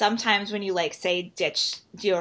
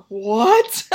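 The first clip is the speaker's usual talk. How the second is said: loud, astonished outburst; mock mind-blown; 3 semitones higher, 9 dB louder than usual. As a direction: blurt it out in retold amazement. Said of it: "just blew my mind".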